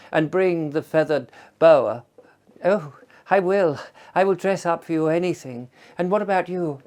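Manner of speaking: a hint of a gushing posh woman; mild put-on lady's voice; posh lady caller.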